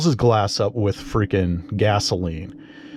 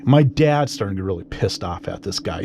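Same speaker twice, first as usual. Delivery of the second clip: ordinary talk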